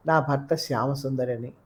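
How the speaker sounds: ordinary delivery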